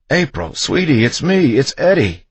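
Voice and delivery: high, loud desperate plea; urgent pleading shout. A narrator's reading. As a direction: yell to make someone recognise you. Husband pleading with shrieking wife.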